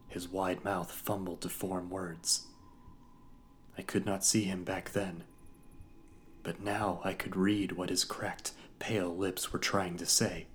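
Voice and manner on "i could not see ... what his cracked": usual speaking voice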